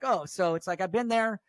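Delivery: usual speaking voice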